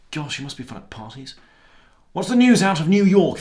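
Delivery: ordinary talk, nothing marked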